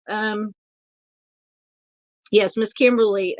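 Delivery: ordinary talk, nothing marked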